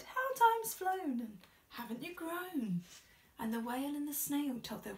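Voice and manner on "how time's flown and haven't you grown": fond snails. A narrator's reading. Flock welcoming the snail home, fond greeting. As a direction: greet the traveller fondly. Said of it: "who said".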